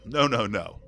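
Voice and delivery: ordinary talk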